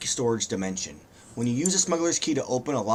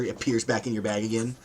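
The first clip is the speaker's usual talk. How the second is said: ordinary talk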